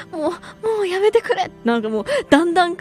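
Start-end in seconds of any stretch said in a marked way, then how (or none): 0.1-1.5 retold plea in a high, thin, crying voice; imitating thin voice. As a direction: mimic dad begging weakly, voice cracking. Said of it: "すっごい細い声でさ".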